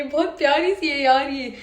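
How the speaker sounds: ordinary talk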